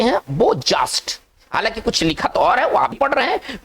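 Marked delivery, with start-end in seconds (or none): 0.3-1.2 बोजास्ट said as "bo-jaast"; बोजास्ट, opening on "bo", drawn out slowly; correct French-word pronunciation. Shown as a demonstration. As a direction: say बोजास्ट with a plain "bo" first, then "jaast". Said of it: "सही उच्चारण की तरफ जाते हैं".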